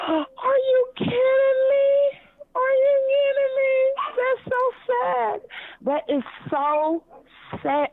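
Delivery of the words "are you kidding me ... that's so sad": much higher, near-crying outrage; shocked disbelief. Real, not acted. Caller reacting to the host's answer, in tearful high disbelief. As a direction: voice breaking up in disbelief.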